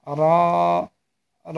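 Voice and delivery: "র" thick and full, vowel held; slightly heavy letter. Shown as a demonstration. Teacher models the letter for learners, "র" held and slightly heavy. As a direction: say "র" heavy and draw it out. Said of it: "এ র হরফটি একটু মোটা হবে".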